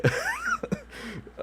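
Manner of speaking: very high squeal of laughter; real high-pitched giggle; squeaky laugh. Real, not acted. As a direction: crack up at yourself. Laughs at failed take.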